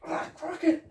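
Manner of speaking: high put-on squeak; squeaky mock voice. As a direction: say it in a silly high voice. Mock-voicing a character.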